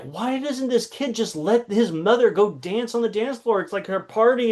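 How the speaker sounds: mock fed-up grumble about the kid; annoyed inner rant. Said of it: "And I was getting so angry".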